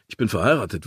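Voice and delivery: plain reading voice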